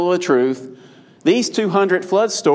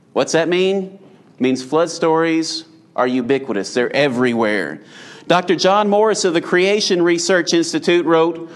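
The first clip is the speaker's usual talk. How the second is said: ordinary talk, nothing marked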